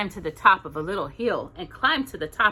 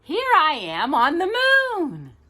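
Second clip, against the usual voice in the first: higher, louder little bear voice; 8 semitones higher, 4 dB louder than usual. Acted. Reading to Jocelyn, Little Bear amazed on the moon.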